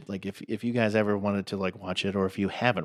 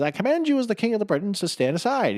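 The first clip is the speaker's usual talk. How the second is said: high, loud regal command; hammed-up king of the Britons; mock king; 12 semitones higher, 3 dB louder than usual. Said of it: "He says".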